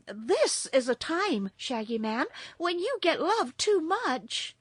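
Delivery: put-on high, lightly girlish voice; high character voice, remarking earnestly; earnest Dorothy. A narrator's reading. Character dialogue in story.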